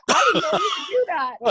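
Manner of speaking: high, loud imitated wail; hammed-up tearful whine; mock crying. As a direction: mimic a kid wailing in protest. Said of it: "he's, like, crying".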